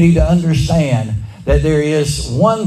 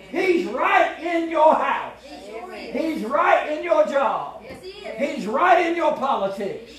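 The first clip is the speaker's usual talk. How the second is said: high, strained preacher's cry with much softer level; full-force preaching climax; 5 semitones higher, 8 dB quieter than usual. Acted. Preacher urges the church to stand up to its Ahab. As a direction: cry it out high and slow, pressing the point.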